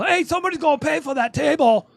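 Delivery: high, loud dwarf shout; yelling across a bar. Acted. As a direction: holler it from across a noisy bar. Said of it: "a dwarf from across the bar yell".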